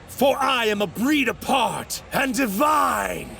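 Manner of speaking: slow, high, swelling proclamation; grandiose boast. Acted. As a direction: proclaim your own divinity, drawn out. Villain exalts himself.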